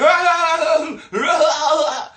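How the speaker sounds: loud, high-pitched cackle; exaggerated group laugh at nothing; mock laughter. Energy vampires laughing at a passerby, mocking cackle. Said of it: "They'll be like".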